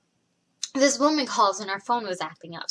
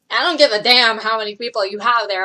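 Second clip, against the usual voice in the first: retold angry comeback, punched louder at the start; mildly heated, putting the caller in their place; fed-up retort; 7 dB louder than usual. Worker dismissing the caller's four witnesses, fed up.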